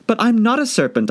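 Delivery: put-on high girlish protest; insist, flustered; protesting Alice. Alice denying being a serpent.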